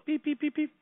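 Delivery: high beep beep of a pager; beeper imitation. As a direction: chirp the beeper sound up high.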